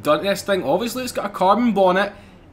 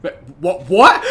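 ordinary delivery

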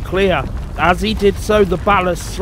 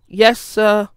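higher, mildly nervous reply; 6 semitones higher, 3 dB louder than usual. A narrator's reading. Thomas dreads meeting Gordon, mildly nervous. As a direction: answer meekly, pitched up. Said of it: "said Thomas nervously".

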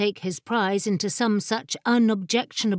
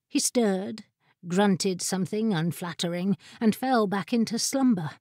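ordinary delivery, nothing marked

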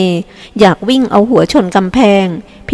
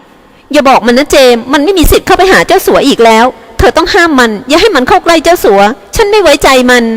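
higher, urgent angry woman; frantic forbidding; 6 semitones higher than usual. A narrator's reading. Widow forbidding the son's visit. (สั่งห้ามเสียงสูงอย่างร้อนรน)

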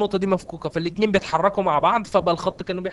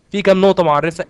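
louder repeated question; 8 dB louder than usual. Shown as a demonstration. Teacher repeating a question to the students, loudly prodding. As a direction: ask again much louder, prodding the class. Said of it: "ها؟".

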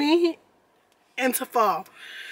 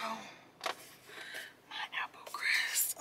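hushed delight over her drink; whisper; 2 semitones lower, 14 dB quieter than usual. Real, not acted. Whispering to viewers about her treat. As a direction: breathe it like a secret treat.

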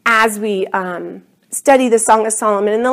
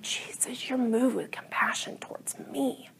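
hushed whisper to Jesus; intimate, voiceless murmured prayer; 17 dB quieter than usual. Acted. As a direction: whisper it in quiet wonder. Acting a private awed prayer.

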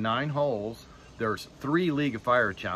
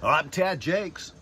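radio announcer voice; 5 semitones higher, slower than usual. Pretend. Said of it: "Sound like a radio guy".

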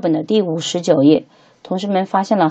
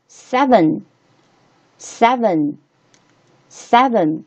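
seven said slowly with pauses between repeats; spaced, careful seven for learners to copy; slow model reading; 3 semitones higher, much slower than usual. Shown as a demonstration. Teacher models number for repetition.

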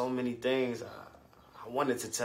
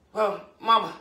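a hint of a higher, louder child; child appealing to Mama; 7 semitones higher, 6 dB louder than usual. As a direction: try to get Mama's attention. Child trying to get mother's attention.

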